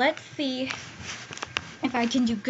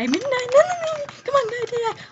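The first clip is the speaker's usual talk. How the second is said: high, loud frantic cry; loud high panic over dying; panicked shriek; 12 semitones higher, 6 dB louder, faster than usual. Real, not acted. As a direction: shriek as the fight turns against you. Losing a fight, panics.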